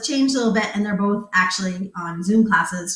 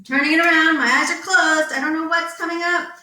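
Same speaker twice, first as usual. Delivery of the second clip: usual speaking voice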